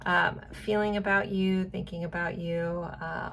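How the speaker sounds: usual speaking voice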